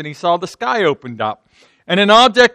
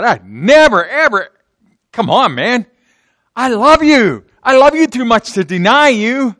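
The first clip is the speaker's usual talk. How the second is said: voiced Peter, pitched higher, insisting he loves Jesus; Peter pleading; 6 semitones higher, 4 dB louder than usual. Peter protesting loyalty to Jesus.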